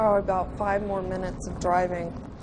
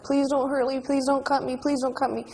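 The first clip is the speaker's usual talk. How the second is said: put-on higher, rushed child's pleading; retold terrified plea; 5 semitones higher than usual. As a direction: retell a frightened child begging. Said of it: "crying, I'm terrified, I'm shaking".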